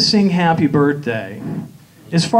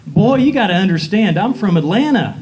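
put-on Southern accent; 2 semitones higher than usual. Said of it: "Italians like Southern accents".

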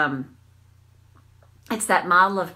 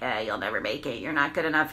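low, soft, sneering hag voice; Hagatha inner critic; 7 semitones lower, 5 dB quieter, faster than usual. Acted. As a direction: voice the nasty critic inside your head. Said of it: "I always animate that voice. I call it Hagatha".

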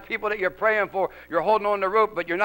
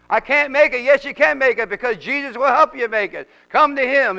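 raised, urgent exhortation in driving phrases; impassioned preaching; 3 semitones higher, 4 dB louder than usual. Real, not acted. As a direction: build up and answer every doubt emphatically. Preacher exhorts the tempted and bound.

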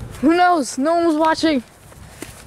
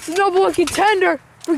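high excited ringside call; wrestling commentator; 7 semitones higher than usual. Acted. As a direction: shout the count like a commentator. Live wrestling play-by-play.

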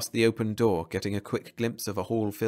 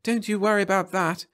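higher-pitched voice for the wife; 11 semitones higher, 4 dB louder than usual. A narrator's reading. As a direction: toss it back while leaving. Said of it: "said Laurie over her shoulder".

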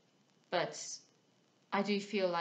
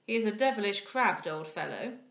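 louder, emphatic complaint; indignant cry; 7 dB louder than usual. A narrator's reading. Sir Robert complaining about the stern stranger, indignant cry. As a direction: exclaim it with mild indignation. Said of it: "cried Sir Robert".

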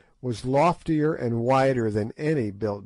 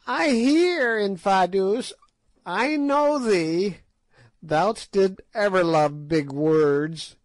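piping witch; 6 semitones higher, 3 dB louder than usual. A narrator's reading. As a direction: pipe it out like an ancient sly witch. Narrator voices Gagool.

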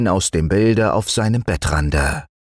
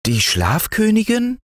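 put-on very high, rising childlike question; amazed little boy; astonished boy. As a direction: echo it in wide-eyed amazement. Boy astonished, big eyes follow.

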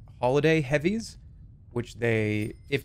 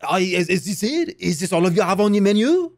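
French accent; 5 semitones higher than usual. Shown as a demonstration. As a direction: ask it like a snooty French waiter. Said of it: "With a French accent".

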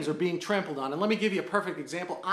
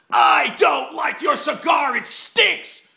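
louder, higher, slow whiny complaint; indignant punk; 7 semitones higher, 7 dB louder, slower than usual. Retold or imitated. Indignant punk complaining about the cigar smell, loud and high. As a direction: mimic the indignant punk loudly. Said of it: "this little indignant punk".

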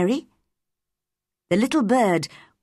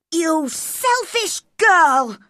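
loud, slow, raised cry; cried out angrily; 9 semitones higher, 4 dB louder, much slower than usual. A narrator's reading. Colin shouts at Mary, slow angry cry. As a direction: cry it out in fury. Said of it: "cried Colin".

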